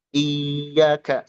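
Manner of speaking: iyyaka with the y held long and nasal; wrong held iyyaka. Shown as a demonstration. Teacher shows a mistaken iyyaka with nasal hum. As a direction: drag out iyyaka, slow and held. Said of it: "jangan sampai ada gunna".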